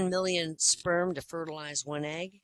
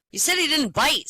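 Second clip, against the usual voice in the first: higher and louder, outraged; indignant mailman; 9 semitones higher, 7 dB louder than usual. Acted. Bitten mailman yelling at the boy, higher and louder. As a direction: bitten mailman protesting, raised voice.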